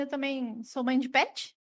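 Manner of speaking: ordinary talk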